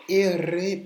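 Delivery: R as a high, very loud "erre"; R roulé. Shown as a demonstration. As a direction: hammer out erre with a long trill. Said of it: "qui n'ont pas du tout aimé cette lettre". (erre roulé, très fort)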